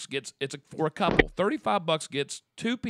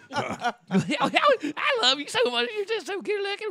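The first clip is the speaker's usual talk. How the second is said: high disguised woman's voice; Michelle voice; 13 semitones higher, 4 dB louder, slower than usual. Pretend. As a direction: put on a high silly woman's voice. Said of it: "I'm gonna talk like Michelle".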